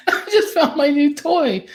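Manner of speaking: giggling high over the words; bubbling up with laughter; laughing with delight. Real, not acted. Cohost laughing at a new gadget, delighted giggle.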